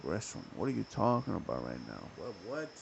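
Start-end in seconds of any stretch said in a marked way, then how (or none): none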